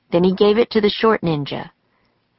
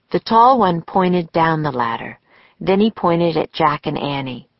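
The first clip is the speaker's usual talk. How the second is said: plain reading voice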